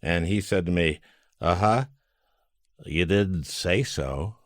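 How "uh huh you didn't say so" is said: slow, dry, lightly voiced Bob; a hint of Bob's deadpan, drawn out slow; Bob impression. Telling how Bob answered at the Christmas party, slow dry Bob. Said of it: "Pretty good Bob, by the way".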